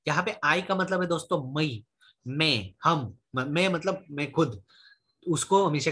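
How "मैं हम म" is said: ordinary delivery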